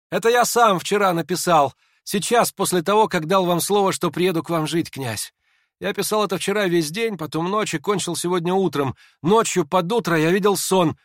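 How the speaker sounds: high, pressing, agitated account; keyed-up, high-voiced confession; feverish explaining. A narrator's reading. Feverish Hippolyte explains the packet.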